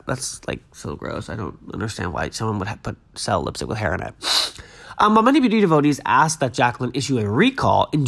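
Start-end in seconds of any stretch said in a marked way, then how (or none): 0.1-4.1 disgusted hush. Real, not acted. Disgusted by hair in lipstick.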